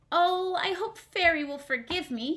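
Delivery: high worried puppet voice; hopeful puppet. Acted. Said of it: "he thought".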